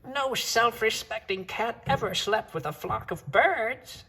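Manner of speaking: high, slow scolding mother voice; scolding mama. Acted. Mother cat scolding her son for sleeping with birds, high and slow. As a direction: tell him off like an exasperated mother. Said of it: "she scolded".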